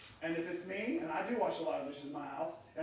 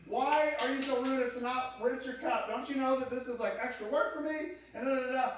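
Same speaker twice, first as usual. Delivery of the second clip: mock angry wife, higher and louder; retold scolding; 7 semitones higher, 6 dB louder than usual. Wife scolding husband over the crusty cup, mock angry. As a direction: ham up an annoyed wife's rant.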